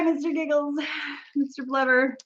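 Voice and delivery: normal talking voice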